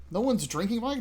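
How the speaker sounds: the penny drops, hammed-up alarm; alarmed disbelief. Player reacting to game crisis.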